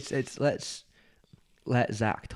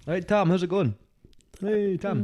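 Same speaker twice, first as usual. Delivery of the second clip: put-on Scottish accent; 9 semitones higher, slower than usual. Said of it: "is that just saying Tom with the Scottish accent".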